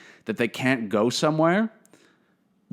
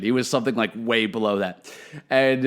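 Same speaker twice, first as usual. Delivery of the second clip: normal talking voice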